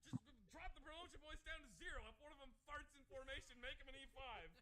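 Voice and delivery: high-pitched exasperated Army order; mock desperation. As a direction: imitate a desperate institution lowering its standards. Acted Army in desperate shortage.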